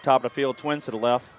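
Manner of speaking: ordinary talk, nothing marked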